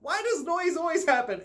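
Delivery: high-pitched fed-up whine; exasperated complaint. Real, not acted. Vlogger annoyed by constant noise, high exasperated whine.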